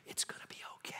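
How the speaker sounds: soft whisper; tender whisper; whispered reassurance. Acted. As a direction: whisper it close to the ear. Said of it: "he whispers in your ear".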